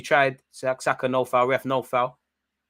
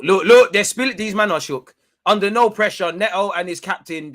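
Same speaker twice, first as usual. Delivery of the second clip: louder, higher gleeful pointing out; excited gloating; 5 semitones higher, 7 dB louder than usual. Real, not acted. Fan gloating that the opponents are shook, excited. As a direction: crow over the rattled opponents.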